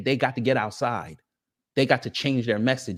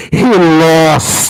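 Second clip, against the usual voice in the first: lost yelled much louder; yelled punchline; 3 semitones higher, 15 dB louder than usual. Shown as a demonstration. Streamer redoes his punchline as a loud yell. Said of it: "I do this differently here".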